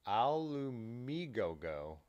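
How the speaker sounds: "Alumigogo" sounded out slowly, syllable by syllable; slow pronunciation try. Real, not acted. Streamer sounding out an odd website name slowly. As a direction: slowly work through "Alumigogo". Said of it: "Alumi-, alum".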